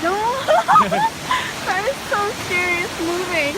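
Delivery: high frightened cry and laugh. Real, not acted. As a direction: react in real fright. Car sliding, scream.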